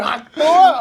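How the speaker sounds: loud, high catchphrase outburst; mock spitting shout. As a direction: belt it out to tease. Teasing friend with shouted catchphrase.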